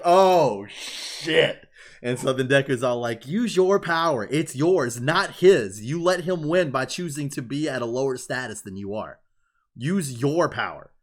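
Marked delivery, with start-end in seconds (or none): 0.0-1.7 loud, higher awed exclamation; retold stunned reaction. 3.3-10.9 loud, higher-pitched acted plea; impassioned urging. Acts out Deku urging Todoroki.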